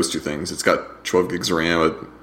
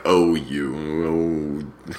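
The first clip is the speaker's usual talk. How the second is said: pitying coo; slower than usual. Pretend. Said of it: "it's like a pity thing".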